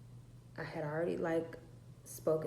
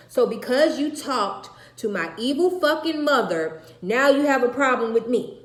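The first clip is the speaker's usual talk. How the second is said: loud angry shouting, higher; furious yelling; 7 semitones higher, 13 dB louder than usual. Retold or imitated. Mother yelling at her daughter in the car, furious. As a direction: yell it at her, fed up.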